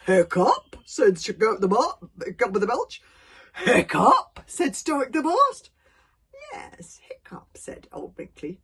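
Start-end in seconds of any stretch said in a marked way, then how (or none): 0.1-0.8 booming questioning repeat; loud incredulous echo. Acted. Gobber reacts to the news, loud disbelief. 3.6-4.4 high, loud astonished question; loud incredulous echo. Acted. Stoic reacts to news of his son, high loud disbelief. 6.5-7.6 slow, soft, breathy confirmation; quiet old elder. Acted. Old Wrinkly confirms it to the chief, slow and hushed.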